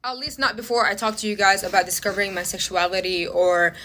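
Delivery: quiet, sincere, owning the mistake; sincere apology. Real, not acted. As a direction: drop the rant and apologize earnestly. Apology after the rant.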